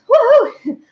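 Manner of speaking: high, loud victory cheer; triumphant whoop. Real, not acted. Cheering to viewers that she got the wasp out, triumphant. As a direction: celebrate the win with a whoop.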